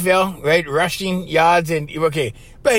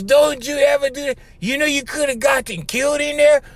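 retold yelling; 7 semitones higher than usual. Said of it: "Now he starts yelling at me".

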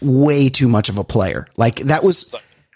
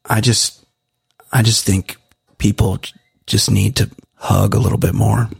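smooth earnest guy; 6 semitones lower than usual. Retold or imitated. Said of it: "And he goes".

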